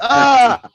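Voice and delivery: sudden scared shout, very high; startled yell. Real, not acted. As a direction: jolt and yell in fright. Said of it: "Sorry, I didn't mean to yell".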